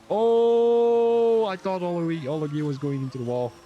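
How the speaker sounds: sudden high startled cry; surprised shout. Real, not acted. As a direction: jolt up in alarm. Sudden near crash.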